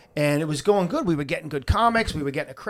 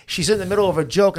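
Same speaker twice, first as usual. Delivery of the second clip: usual speaking voice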